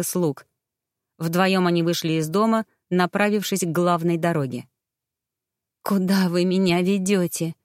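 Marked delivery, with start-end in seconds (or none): none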